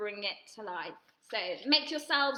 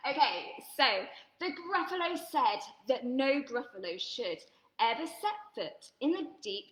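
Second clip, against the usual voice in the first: ordinary delivery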